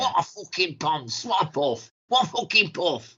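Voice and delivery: lightly voiced sneering [name]; mock [name] jeer. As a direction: jeer like a sulky rock star. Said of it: "And [name]'s going".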